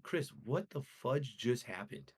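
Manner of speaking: slow, dazed mock disbelief; a friend replaying his own shock, slow and dazed; stunned disbelief. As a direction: ask it slowly like you can't believe it. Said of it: "and I was like".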